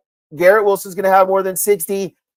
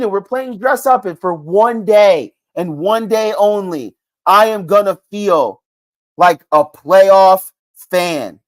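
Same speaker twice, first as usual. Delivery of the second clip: ordinary talk, nothing marked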